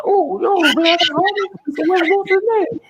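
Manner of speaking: mock-excited high-pitched prison buddy; happy homie. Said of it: "He said he was happy at first".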